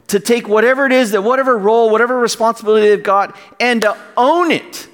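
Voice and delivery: high-pitched, fervent preaching; real fervor, pitched high and emphatic; impassioned yelling. Real, not acted. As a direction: preach it with rising passion, voice pitched well up. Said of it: "I'm sorry, I'm yelling".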